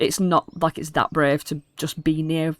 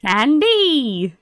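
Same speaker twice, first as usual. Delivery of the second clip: goblin hawker; 8 semitones higher, 3 dB louder than usual. Acted. Goblin vendor call.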